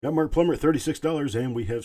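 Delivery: loud, high call of the winning bid; excited sale call. Real, not acted. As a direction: belt out the sold price like a hammer drop. Auctioneer calling the winning sale.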